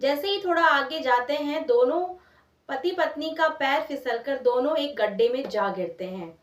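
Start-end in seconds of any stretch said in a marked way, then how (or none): none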